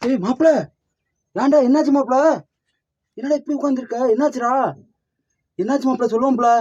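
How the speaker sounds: high-pitched, alarmed questioning; anxious what-happened questions, pitched high; worried urging. Acted. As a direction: press a friend in a high voice to explain. Urges ruined friend to explain.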